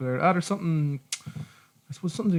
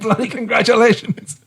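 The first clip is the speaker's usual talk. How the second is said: slow, higher and louder hammed-up Australian accent with a laugh; worse Australian accent; 7 semitones higher, 3 dB louder than usual. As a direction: ham up the Australian accent even more, stretching out Bloody congratulations. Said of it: "That's even worse".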